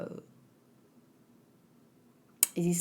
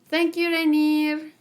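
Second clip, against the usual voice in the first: excited thanks; 7 semitones higher, 6 dB louder, slower than usual. Real, not acted. Thanking subscriber, excited shout.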